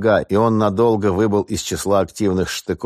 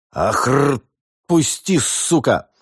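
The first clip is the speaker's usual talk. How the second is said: choked cry of pain, pitched high; strained yell; 7 semitones higher, much slower than usual. Acted. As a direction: yell as if hoisted by the collar. Captive lifted by collar struggling.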